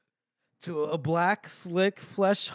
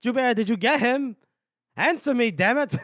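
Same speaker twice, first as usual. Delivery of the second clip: calling out; 6 semitones higher than usual. Retold or imitated. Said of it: "Casca goes".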